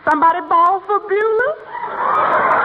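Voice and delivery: slow, high, laughing reply; maid's entrance. Acted. Maid answering the call from offstage, slow high laughing reply. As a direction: sing out your entrance line.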